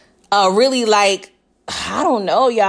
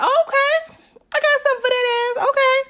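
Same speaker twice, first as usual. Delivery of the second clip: high-pitched, quick mimicked retort; mock sly comeback; 14 semitones higher, faster than usual. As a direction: imitate her plotting payback in a high voice. Acting Diana's scheming comeback.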